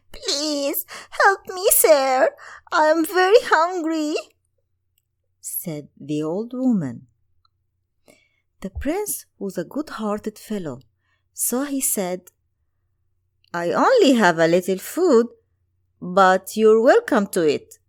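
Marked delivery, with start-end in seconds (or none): 0.1-4.1 put-on much higher, louder, crying old woman begging; a hungry old crone whimpering for help; pleading old woman. Old woman begs, looked sad. 13.5-17.8 put-on louder, quick kindly young prince. Prince voice in read story.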